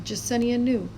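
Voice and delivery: ordinary delivery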